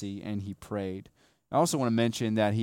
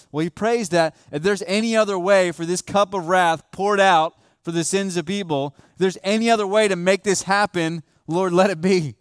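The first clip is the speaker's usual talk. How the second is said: loud, high, ringing preacher's cry; preaching at full force; 6 semitones higher, 6 dB louder than usual. Acted. Pastor to congregation, retelling Christ's plea, fervent loud preaching.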